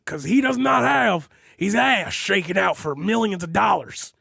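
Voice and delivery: a loud pundit praising Limbaugh, half-hearted; Alex Jones impression. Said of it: "in my best Alex Jones voice".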